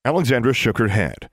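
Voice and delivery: usual speaking voice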